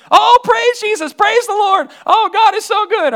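high, loud joyful cries; high, loud mock rejoicing of the relieved friend; overjoyed praise. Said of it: "I got a call from her".

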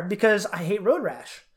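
usual speaking voice

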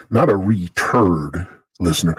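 usual speaking voice

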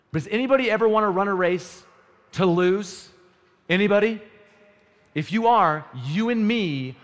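loud, high challenge to the crowd; preaching challenge. Acted. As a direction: dare them to answer. Challenging the congregation.

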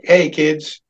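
lightly voiced uninterested greeting; a token hello, mildly mimicked; imitated indifferent grandpa. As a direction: acknowledge the kids with little enthusiasm. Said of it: "And he'd be like".

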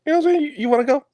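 very high joking squeak; squeaky high voice. Pretend. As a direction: push the voice way up for a joke.

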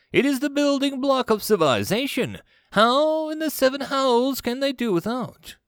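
high-pitched, outraged disbelief; incredulous commander. Acted. Commander baffled by primitive planet, incredulous.

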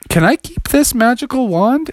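begging the wizard; Froggy voice. Acted. Froggy asks.

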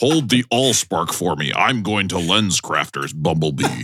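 commanding robot drawn out toward a wrestler's growl; Optimus Prime. Acted. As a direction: play the weighty hero, very slowly. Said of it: "Suspiciously close to the Hulk Hogan voice".

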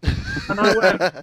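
slow and drawn out in disbelief; stunned. Retold or imitated. Visitor reacting to the kid, slow and stunned. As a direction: hang on the words, stunned.

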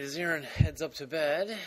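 ordinary talk

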